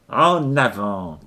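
loud, drawn-out marching order; long, ringing order; shouted command. A narrator's reading. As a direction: call the troop forward, slowly and loudly.

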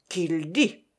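understated menacing growl; grunted, contained ferocity; ferocious growl. A narrator's reading. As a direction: growl it out fiercely, without raising the volume. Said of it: "grogna férocement chéri Bibi".

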